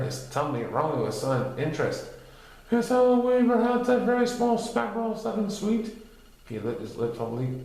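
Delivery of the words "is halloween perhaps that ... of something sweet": high curious Pooh voice; a perked-up little bear. A narrator's reading. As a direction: Pooh with sudden interest. Pooh's voice.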